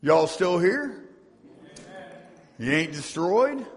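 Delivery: normal talking voice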